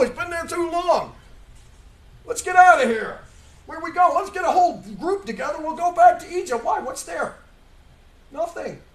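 rally the grumbling crowd to leave; impatient crowd. Acted. Preacher acting Israelites urging return to Egypt, loud.

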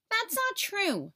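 put-on high, slow little girl protesting; insist, wounded; lightly voiced upset girl; hurt protest. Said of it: "said Virginia".

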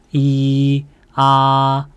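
"이" and "아" held high and perfectly level; first tone. Shown as a demonstration. Teacher drills first tone: "이" "아" high and flat. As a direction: sustain each of "이" and "아" flat on one high note. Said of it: "편안하게 발성하세요".

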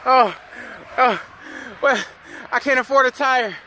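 high, hammed-up panic; lightly hammed-up panic over the cost of a tire; mock freak-out. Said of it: "you go all crazy".

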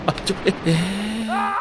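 real slow drawn-out yell of alarm; alarmed shout. Real, not acted. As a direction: cry out as you slip. Character slides unexpectedly.

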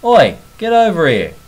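loud call, high and forceful; shouted oi. Shown as a demonstration. Shouting oi to a mate across the yard, loud call. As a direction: yell across to a mate, oi get over here. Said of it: "Example sentence".

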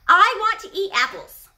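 put-on a pushy kid's "I want"; rude demand. Shown as a demonstration. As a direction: demand it without manners. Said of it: "Is that a polite way to do it?".

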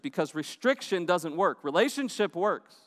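ordinary talk

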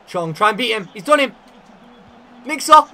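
rising from eager to a very high, loud yell; building excitement. Real, not acted. Last chance of the semi-final, excitement building to a yell.

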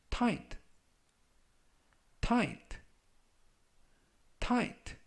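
"tight" three times, long pause after each; slow model reading. Shown as a demonstration. Teacher reviews vocabulary for followers, slow model reading. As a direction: read out "tight" slowly for learners to repeat. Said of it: "천천히 세 번씩 읽어드리고요".

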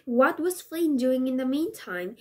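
plain reading voice

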